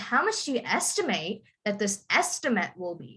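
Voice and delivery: verb "estimate" ending in a rising full "ate", noun "estimate" front-stressed; noun-verb stress contrast. Shown as a demonstration. Teacher showing a student how to say the sentence, noun-verb stress contrast. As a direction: model "estimate" as verb with stressed end, then as noun with stressed start. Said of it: "So you would say".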